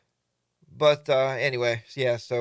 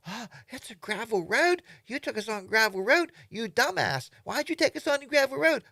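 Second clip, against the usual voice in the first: much higher put-on exasperated voice; mock annoyed rider; 10 semitones higher, 4 dB louder than usual. Rider scolding the leader over a gravel road, mock exasperation. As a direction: complain at the ride leader in disbelief.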